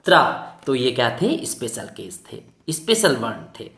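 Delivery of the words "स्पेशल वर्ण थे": ordinary delivery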